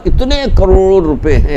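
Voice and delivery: high, drawn-out mock amazement; mock awe. Preacher imitating gossips over an actor's riches, mock awe. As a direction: stretch it out like an impressed gossip. Mimics people gossiping about a star's wealth.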